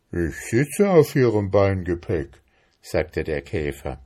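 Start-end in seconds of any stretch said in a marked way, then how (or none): 0.1-2.4 put-on plodding beetle voice; stolid beetle. A narrator's reading. Beetle defending himself to ant, stolid. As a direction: answer like a put-upon beetle.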